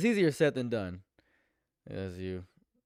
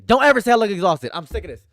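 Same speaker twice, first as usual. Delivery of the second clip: sudden furious shout, much higher and louder; real angry outburst; 10 semitones higher, 16 dB louder, faster than usual. Real, not acted. Streamer blowing up at chat in a furious shout. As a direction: snap at chat for calling him exhausted. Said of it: "I'm crashing out".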